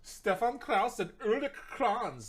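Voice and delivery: slow, high, loud hammed-up German accent on the names. Vlogger mocks the founders' names in a hammed-up German accent. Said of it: "I gotta stop doing my German, my German accent".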